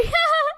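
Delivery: high tearful laugh breaking into a sob; a real half-laugh, half-sob. Real, not acted. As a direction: laugh through the tears as they come. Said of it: "Maybe I am tearing up".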